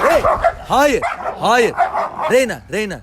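high, urgent no to the dog; sharp command. Real, not acted. Owner urgently calls his dog Reyna off, pitched high.

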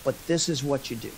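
low, hushed scheming aside; acted sly counsel. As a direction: confide a plot as the crafty prophet. Balaam slyly advising the king.

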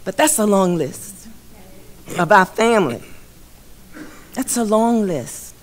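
holding back tears, voice catching; choked up while reading the names; emotional. Real, not acted. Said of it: "that I'm getting emotional".

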